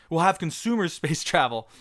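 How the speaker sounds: correcting "consumer" through a laugh; laughing reread. Real, not acted. Streamer laughs fixing his misread of a fan's chat. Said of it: "What am I doing?".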